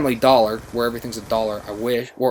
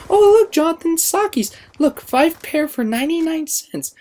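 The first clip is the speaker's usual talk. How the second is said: grandmother's voice; 4 dB louder than usual. Retold or imitated. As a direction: coo it like a thrilled old lady. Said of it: "She goes".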